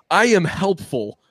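overeager, high and earnest; eager helper voice. Acted. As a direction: play a keen little helper character. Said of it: "the guy that's tripping over himself".

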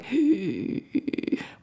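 trembling gasp of a scared crab; frightened gasp. Acted. Podcaster playing a crab hiding in its shell, frightened gasp. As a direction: act out a panicked intake of breath. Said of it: "I'm shaking in my shell going".

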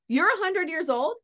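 loud, high-pitched retold disbelief; incredulous question. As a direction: question her age in astonishment, voice jumping up. Retelling disbelief at passenger's age.

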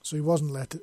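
plain reading voice

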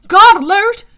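high, very loud warning shout; panicked horse sidekick yelling; alarmed yell. Acted. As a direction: yell the alarm to your rider. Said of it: "Blackjack yelled".

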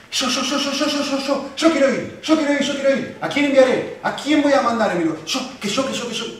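Isaiah jumping in to volunteer, eager and high. Acted. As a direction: burst out wanting to be sent. Preacher voices eager volunteering Isaiah.

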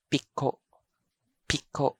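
piko as short pi'-ko', each vowel cut off; piko with glottal stops. Shown as a demonstration. Teacher gives the alternate pronunciation, piko doubly stopped. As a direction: catch both vowels of "piko" in the throat. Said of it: "glottal catch for each vowel".

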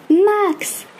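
high yell through cupped hands; hollered name. Acted. Friend yells a name to the distant surfer, high hollered call. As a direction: call his name out across the beach. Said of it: "place ses mains en porte-voix et hurle".

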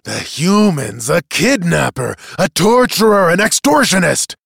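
loud, rising to a high shout; seething accusation. Acted. Accuser leaps up before the tribunal, seething.

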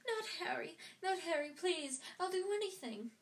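high, crying plea for her son; desperate pleading mother. A narrator's reading. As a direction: beg for his life, crying out.

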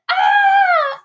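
long, high imitated baby shriek; mock baby squeal, loud; wordless baby squeal. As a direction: shriek like a baby who can't talk. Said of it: "Cause I didn't know how to talk".

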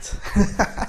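short unvoiced laugh; breathy laugh. Real, not acted. Laughing after coughing fit.

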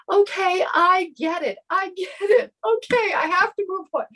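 high, tearful voice catching on a sob; reliving the moment she broke down crying at the creek; retold sobbing. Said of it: "just uncontrollably sobbing".

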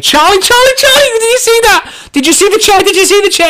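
very high, loud hysterical screech; full-tilt re-enacted shriek; freaking out. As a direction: scream in panic for a witness. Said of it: "I'm freaking out".

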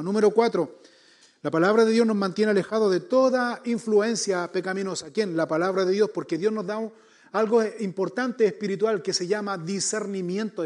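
sermón a plena voz, más fuerte; predicación enérgica. Acted. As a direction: proclamar cada punto con fuerza al público. Pastor predicando con fuerza. (predicación fervorosa y más fuerte)

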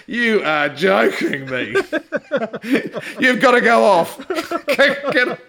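retelling a teammate's disbelief through laughter; cracking up while quoting him; amused mock disbelief, broken by laughs; laughing impression. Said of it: "I can see Steve Smith going".

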